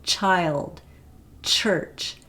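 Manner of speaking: put-on careful "Church", "child" with crisp "ch"; example words. Shown as a demonstration. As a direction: hit the "ch" sounds. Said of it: "voiceless one".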